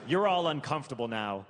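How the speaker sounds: normal talking voice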